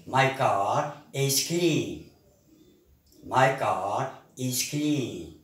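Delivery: slow, careful, each word clear; slow model sentence. Shown as a demonstration. Teacher modelling a sentence for the class, slow and clear. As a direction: spell it out slowly for learners.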